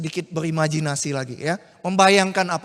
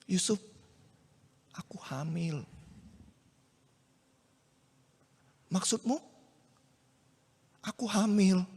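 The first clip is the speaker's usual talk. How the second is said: much softer, halting confession; hushed confession; 7 dB quieter than usual. Acted. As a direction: barely dare to say it. Maria confesses pregnancy, hushed. (Maria mengaku hamil kepada Yusuf, berbisik lirih)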